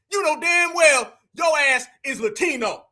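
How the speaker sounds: normal talking voice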